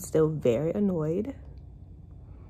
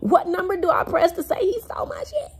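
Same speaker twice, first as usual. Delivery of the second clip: incredulous high question; 12 semitones higher than usual. Real, not acted. Joking frustration.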